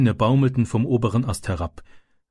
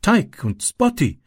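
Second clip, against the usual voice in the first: put-on higher, slow boy's voice; higher child voice, slowly naming the bullies; Ram naming names; 8 semitones higher, slower than usual. A narrator's reading. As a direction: spell out the two culprits slowly. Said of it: "sagte Ram".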